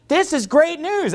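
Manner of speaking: high-pitched, delighted exclamation; imitated happy convert. Preacher imitates converts hearing the gospel, happily excited. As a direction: imitate someone thrilled by good news. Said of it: "other people have a different emotion".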